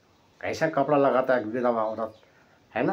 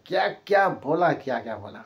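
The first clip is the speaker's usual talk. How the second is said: normal talking voice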